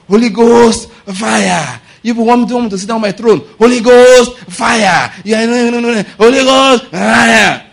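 loud, high war-cry chant; shouted prayer. Acted. Preacher mimicking David praying against Absalom, shouting. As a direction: bellow it like fervent warfare prayer, mounting. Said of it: "you can shout".

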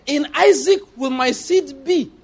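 high, slow preaching of the promise; solemn scripture quote. Acted. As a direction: proclaim the promise slowly. Quoting God's promise to Abraham.

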